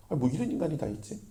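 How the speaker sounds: normal talking voice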